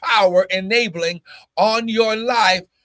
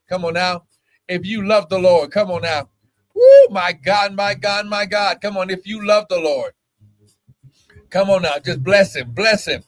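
fervent praise; 2 semitones higher than usual. Real, not acted. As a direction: lift the congregation in praise. Praise and worship exhortation.

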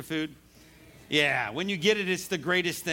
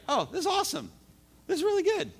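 high-pitched delighted exclaiming; mock delight; 13 semitones higher, faster than usual. Preacher acts out someone loving favorite food, mock delight. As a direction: gush in a much higher voice.

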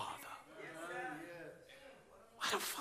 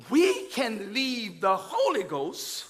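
usual speaking voice

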